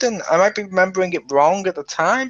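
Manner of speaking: usual speaking voice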